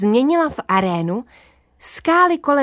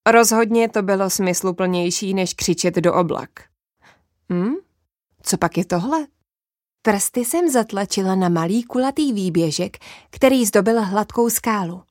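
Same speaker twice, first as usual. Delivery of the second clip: ordinary delivery, nothing marked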